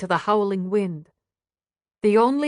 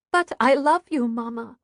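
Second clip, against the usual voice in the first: put-on a little girl's plea, dropping away at the end; loving child; 4 semitones higher than usual. A narrator's reading. Daughter answering her mother's warning, tender and higher. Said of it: "daughter answers her mother".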